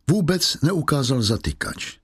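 ordinary delivery

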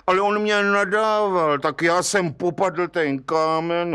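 high, drawn-out self-justification; whining excuse. Acted. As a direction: protest plaintively in a high voice. Culprit excuses himself to judge.

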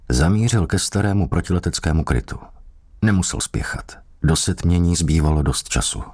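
ordinary delivery

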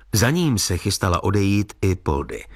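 ordinary delivery, nothing marked

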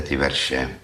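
ordinary delivery, nothing marked

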